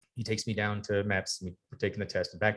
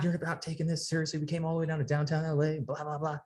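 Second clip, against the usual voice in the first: higher, imitated angry recruiter; mock scold a lazy recruit; livid recruiter; 7 semitones higher than usual. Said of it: "my recruiter's fucking livid".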